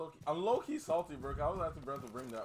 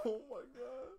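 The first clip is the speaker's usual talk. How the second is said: laughing; 12 semitones higher than usual. Real, not acted. Laughing fit.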